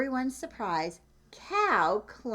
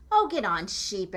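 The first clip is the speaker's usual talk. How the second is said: group shout; 6 dB louder than usual. Acted. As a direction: urge her on, loud. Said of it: "everyone shouted".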